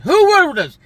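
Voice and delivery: high, loud, demanding who; loud challenge. Real, not acted. Challenging viewers about the vote, loud and high. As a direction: throw the question out loud to the crowd.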